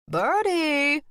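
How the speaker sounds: high, loud call over the shoulder. Acted. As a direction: call out loud to someone inside. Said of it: "Henrietta called over her shoulder".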